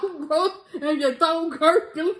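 high teasing laugh through the words; squealing with laughter. Real, not acted. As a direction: tease her while cracking up. Laughing hard at her gurgling.

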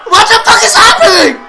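high, loud incredulous shouts with laughter; shocked yelling. Real, not acted. Fan reacting to superhero ponies, shrieking.

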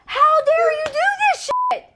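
squeaky high mock outrage; high mock scold. Mom scolding daughter, high mock outrage. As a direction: scold her in a shrill-high playful voice.

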